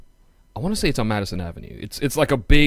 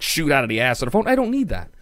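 ordinary talk, nothing marked

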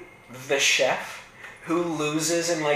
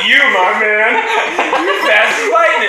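laughing through the words; cracking up mid-sentence; 9 semitones higher, 7 dB louder than usual. Real, not acted. Laughing at a joke.

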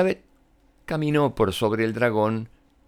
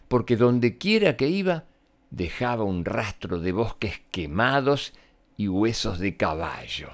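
ordinary delivery

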